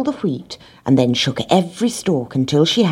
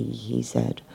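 ordinary delivery

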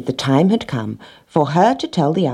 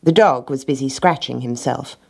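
ordinary delivery, nothing marked

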